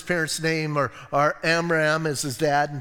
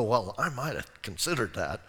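dry joking mom; 7 semitones lower, 6 dB quieter than usual. Retold or imitated. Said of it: "some moms go".

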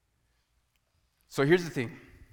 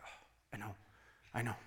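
whispered brush-off; 8 semitones lower, 17 dB quieter, faster than usual. Retold or imitated. Husband brushing off wife's reminder.

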